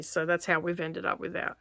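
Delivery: ordinary talk, nothing marked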